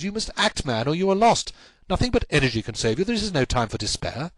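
urgent cry, starting high and easing down; Sherlock Holmes, urgent. Acted. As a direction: rouse the despairing client to act. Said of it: "cried Sherlock Holmes".

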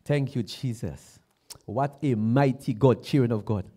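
ordinary delivery

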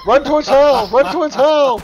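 high, loud warning yell; urgent shout. Real, not acted. Player urging a teammate to run, urgent shouting. Urgent calls during game.

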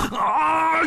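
high desperate blocking yell; straining battle shout. A narrator's reading. Bull King blocks two weapons overhead, high straining shout. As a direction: heave it out high and long. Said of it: "喊了声".

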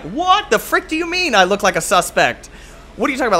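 high-pitched, mock-indignant disbelief; incredulous protest. Retelling being called a suspect, incredulous.

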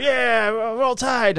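high, slow drawn-out Roll Tide; hammered Alabama fan answering the cop's cheer, slow and high; drunk fan. Retold or imitated.

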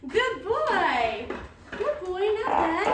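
high, loud delighted praise; bright-pitched, proud cheer; praising a dog. Real, not acted. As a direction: gush to a dog who did well. Praising dog after test.

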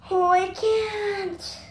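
winded after exercise; puffing between words; out of breath. Real, not acted. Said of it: "My heart's beating".

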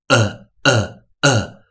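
呃 as a short, separate vowel sound; isolated short-u sound on 呃. Shown as a demonstration. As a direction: say 呃 as a short English u, one beat each. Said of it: "会发出短母音、".

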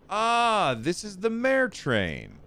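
high, slow, eager exclamation; exclaiming. A narrator's reading. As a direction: stretch it out, delighted. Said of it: "I exclaimed".

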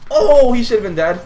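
loud hype reaction; excited shout. Real, not acted. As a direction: yell it at the screen. Reacting to a close fight.

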